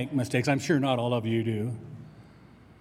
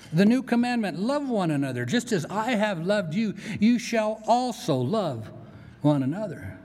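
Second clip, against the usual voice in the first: solemn scripture quote; 6 semitones higher than usual. Acted. Preacher quoting Jesus' commandment.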